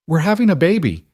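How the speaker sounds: put-on high, excited child's announcement; excited shriek. A narrator's reading. Lily announcing the baby to her parents, excited shriek. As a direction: blurt it out as a mildly shrieked squeal. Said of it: "Lily shrieked".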